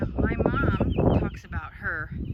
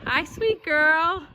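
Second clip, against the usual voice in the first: slow, higher, sweet coo; tender greeting; 6 semitones higher, 4 dB louder, much slower than usual. Real, not acted. Farm woman greeting a young cow, slow and tender.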